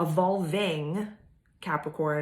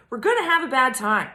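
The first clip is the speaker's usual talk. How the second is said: louder, higher punch on gonna; loud movie-quote delivery; quoted line, louder; 8 semitones higher, 9 dB louder than usual. Retold or imitated. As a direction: belt the catchphrase out. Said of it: "Does anyone know that quote".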